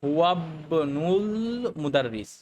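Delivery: very slow হুয়াবনুল, stretched into মুদাররিস; linked reading. Shown as a demonstration. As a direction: draw out হুয়াবনুল as one word, no i or al. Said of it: "আমরা বলি".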